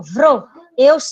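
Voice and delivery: "vro" said alone, loud and clear, so both V and R are heard; syllable isolated. Shown as a demonstration. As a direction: pop the syllable "vro" out on its own. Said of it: "eu ouço o som do V e do R".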